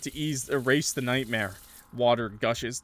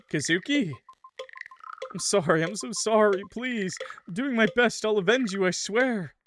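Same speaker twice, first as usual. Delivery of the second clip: desperate apology; 10 semitones higher, 3 dB louder than usual. Acted. Pleading with ghost as character.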